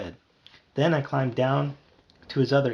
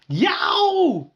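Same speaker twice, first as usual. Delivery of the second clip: very high, loud yelp of pain; piercing startled yowl; surprised shriek; 7 dB louder than usual. A narrator's reading. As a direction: shriek out in shock. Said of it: "he shrieked in surprise".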